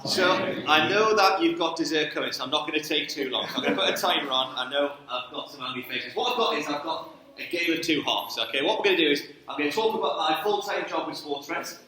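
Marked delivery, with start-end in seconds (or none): none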